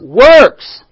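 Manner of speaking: WORKS landed hard with the final s sounding clear; stress on works. Shown as a demonstration. Preacher correcting the congregation's answer, with a firm stress on works. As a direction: lean on the s of "Works.". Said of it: "Not work".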